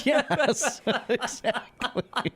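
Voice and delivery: high laughing agreement; laughing yes. Real, not acted. Friend cracking up at the joke, laughing. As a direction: giggle it out.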